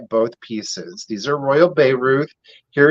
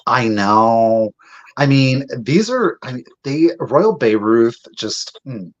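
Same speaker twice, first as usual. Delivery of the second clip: ordinary talk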